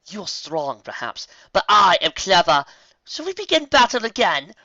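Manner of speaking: high, loud smug taunt; gloating villain; sly dark queen. A narrator's reading. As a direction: tease your sister cleverly.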